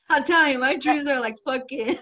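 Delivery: real helpless laughing speech. Real, not acted. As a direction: insist while cracking up. Cracking up at own story.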